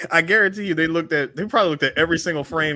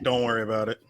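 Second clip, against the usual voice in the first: ordinary delivery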